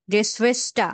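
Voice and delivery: "গেসওয়েস্টা" ending in an open A; final R as A. Shown as a demonstration. Teacher showing student the final German R, said as A. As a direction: end "গেসওয়েস্টা" on a vowel, no R. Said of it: "লাস্টে কি আর মতো উচ্চারণ".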